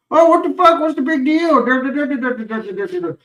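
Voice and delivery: high, loud mocking whine; mocking dismissive people. Mocking people who downplay back pain, in a high whiny voice. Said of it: "act like, you know".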